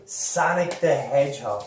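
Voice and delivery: usual speaking voice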